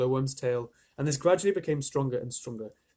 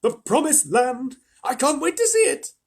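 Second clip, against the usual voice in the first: much higher, louder gleeful cheering; excited shouts; 12 semitones higher, 9 dB louder, slower than usual. A narrator's reading. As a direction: shout it like an eager crowd. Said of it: "mounting excitement and shouts of".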